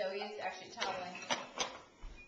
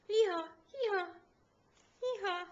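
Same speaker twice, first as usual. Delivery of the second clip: high sing-song hee-haw; donkey bray; 10 semitones higher than usual. Pretend. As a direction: bray like a donkey again for the baby. Donkey noise for baby.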